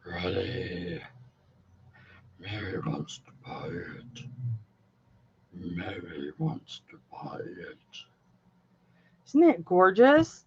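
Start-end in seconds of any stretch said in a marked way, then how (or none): 0.0-1.0 very deep, slow rasping growl; demon voice. Acted. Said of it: "That's my demon voice". 2.4-4.2 very deep, breathless demon whisper. Acted. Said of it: "That's my demon voice". 5.5-7.8 very deep rasping demon growl. Acted. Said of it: "That's my demon voice".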